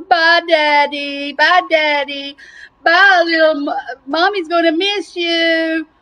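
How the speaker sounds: slow, drawn-out mock wailing; hammed-up mournful farewell, stretching the words; tearful goodbye. Turkey family saying bye to Daddy, mock tearful. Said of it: "Turkey's bawling. His family's going".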